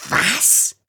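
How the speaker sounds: high, hissed outrage; hissing creature voice. Acted. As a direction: hiss the question in disbelief. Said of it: "zischte Flüsch".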